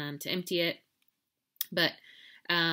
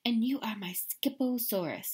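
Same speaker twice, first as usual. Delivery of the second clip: lightly voiced kitty boy, proud; name the model proudly; said out loud; 5 semitones higher than usual. Skippy naming his model dinosaur, proud. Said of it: "he added out loud".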